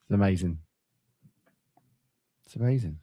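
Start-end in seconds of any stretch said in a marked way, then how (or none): 0.0-0.6 deflated. Real, not acted. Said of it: "I'm broken".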